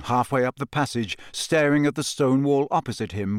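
ordinary delivery, nothing marked

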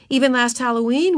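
usual speaking voice

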